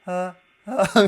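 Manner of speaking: open-throated ah like starting a yawn; yawn-onset voice. Shown as a demonstration. Coach shows the start-of-yawn throat sound. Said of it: "あくびが出る一番最初のタイミングの声".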